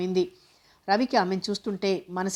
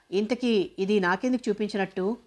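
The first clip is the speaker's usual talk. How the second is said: plain reading voice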